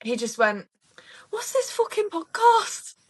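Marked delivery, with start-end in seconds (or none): 1.3-3.0 high, drawn-out mock Scouse; exaggerated Scouse, pitched way up; botched Scouse accent. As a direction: put on a bad Scouse voice. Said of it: "I don't know why I said it's Scouse".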